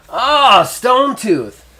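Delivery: put-on loud, high, delighted hail to an old friend; excited stout greeting. Stout greeting Stone Tooth after a long time, loud and high. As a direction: call out in glad surprise.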